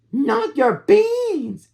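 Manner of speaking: high, slow outraged cry. Acted. Puppy hears the beans were stolen, high and outraged. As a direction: stretch it out in mock outrage. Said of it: "cried Skipito, outraged".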